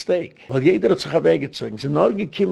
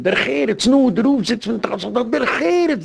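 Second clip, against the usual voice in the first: mock outcry, much higher than usual; retold shouting; 9 semitones higher than usual. Young man heckling the rabbi in his tallis, retold mock shouting. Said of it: "schreit noch einmal".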